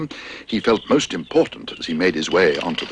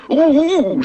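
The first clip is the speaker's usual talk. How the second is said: high, loud excited ooh; excited shout; 5 dB louder than usual. Acted. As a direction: shout it out with glee. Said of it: "shouted Paddington excitedly".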